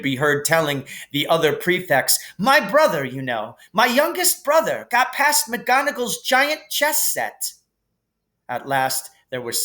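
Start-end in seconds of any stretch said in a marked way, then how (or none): 2.4-7.5 lightly voiced boastful older brother; a hint of proud Percy. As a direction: brag to the other prefects. Percy bragging to prefects.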